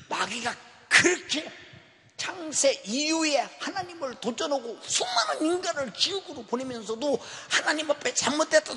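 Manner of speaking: loud, high preaching at full force; fiery preaching. Acted. Pastor thunders at the congregation about the devil, preaching loudly. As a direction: hammer it home to the congregation.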